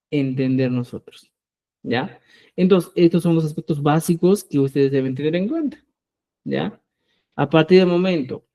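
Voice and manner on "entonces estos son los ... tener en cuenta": ordinary talk, nothing marked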